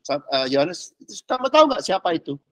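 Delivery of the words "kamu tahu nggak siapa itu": elder asking John. Retold or imitated. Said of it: "si Yohanes ditanyain".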